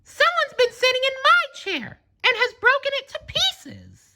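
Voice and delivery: put-on very high, tiny baby bear voice, mildly upset; a little cub whining that his porridge is gone. A narrator's reading. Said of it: "Baby Bear hurried over to his wee little chair".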